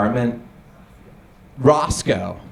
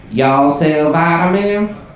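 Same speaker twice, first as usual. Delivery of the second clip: slow, drawn-out put-on Southern accent; mock Southern accent, stretched out; 3 semitones higher, 4 dB louder, slower than usual. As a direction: fake a Southern drawl to pass as local. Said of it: "I just do a Southern accent".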